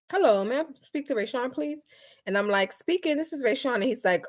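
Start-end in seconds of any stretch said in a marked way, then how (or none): 0.1-1.9 higher, brisk, polite caller voice; imitated phone caller. Caller asking her office for Rayshawn, higher polite phone voice. 2.7-3.8 higher, polite answering voice; office phone voice. Retold or imitated. Answering a caller at work, higher polite phone voice.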